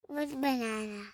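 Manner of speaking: very soft, low whisper. Real, not acted. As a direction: answer in a tiny hushed voice. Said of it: "[name], stop whispering".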